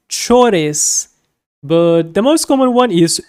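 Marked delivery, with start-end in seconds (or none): none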